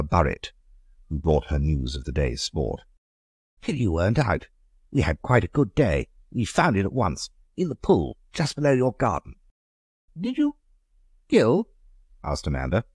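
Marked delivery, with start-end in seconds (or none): 3.7-9.3 a lightly raised lady's voice; breezy gossip about the hunt; Aurora, chatty neighbour. Acted. 10.2-11.7 high, light society lady asking; a much higher lady's voice, curious; Amanda's voice. Acted. Amanda asks anxiously.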